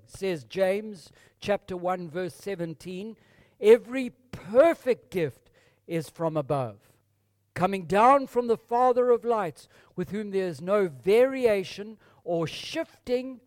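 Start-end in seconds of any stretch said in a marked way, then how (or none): 3.6-13.4 1 proclaiming scripture. Acted.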